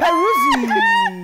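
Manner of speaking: high, slow laughing name call. Real, not acted. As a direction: drag the name out through laughter.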